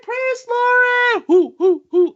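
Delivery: high, loud, drawn-out cheering; happy crowd cheer. Acted. Crowd greeting their priest, cheering loudly. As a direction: cheer him on like a fan. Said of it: "they're like".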